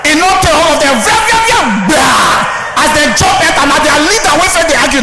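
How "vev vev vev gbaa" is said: high, shouted barking noises; dog attack noises. Retold or imitated. Comedian imitating five dogs charging at robbers, high shouted barking.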